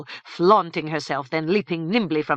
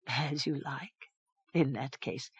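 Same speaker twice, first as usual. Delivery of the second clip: much softer, slow and airy; soft indifferent shrug; 2 semitones lower, 10 dB quieter than usual. A narrator's reading. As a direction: let it go lightly. Twisk indifferent.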